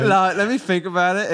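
high, loud mock British, "think" as "fink"; hammed-up rough British woman's accent; ratchet British accent. Shown as a demonstration. Repeating the ratchet woman example, loud British accent. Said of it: "use an F instead of a T H".

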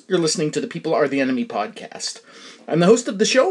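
normal talking voice

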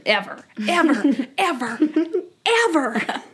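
milk every "ever" for comic finality; mock-dramatic mounting EVERs; playful emphatic repetition. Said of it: "sometimes I just say that".

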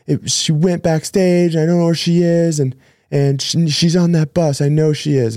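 high, pleading voice of a desperate guy; distraught stranger. Retold or imitated. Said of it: "He's like".

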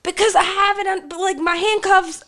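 high, squeaky put-on voice of the friend; quoting the friend in a high silly voice; mock friend voice. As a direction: pipe it up high like a panicky friend. Friend explains from handcuffs.

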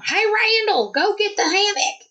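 high mock rowdy partier call; rowdy camper. Woman mimicking rowdy neighbors shouting to Randall, high mock rowdy. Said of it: "they were very rowdy".

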